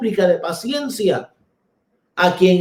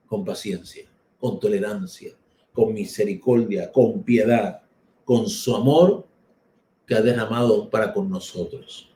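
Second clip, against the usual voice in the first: much softer and lower, gentle and patient; soft, tender; 9 semitones lower, 4 dB quieter than usual. Real, not acted. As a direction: speak it like a quiet prayer of mercy. Predica sobre paciencia y ternura. (tierno y suave, como una oración)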